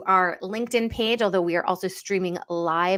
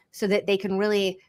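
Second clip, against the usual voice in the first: normal talking voice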